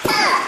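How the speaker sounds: very high, loud sneeze shout; mock explosive sneeze. Comic repeating the man's sneeze, high explosive yell. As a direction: let a huge sneeze burst out. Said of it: "he sneezes like this".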